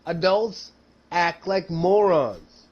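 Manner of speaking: usual speaking voice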